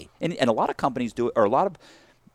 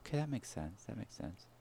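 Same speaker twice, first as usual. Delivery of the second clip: lightly voiced manager, much softer; murmured manager; 18 dB quieter than usual. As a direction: mutter it like a satisfied manager.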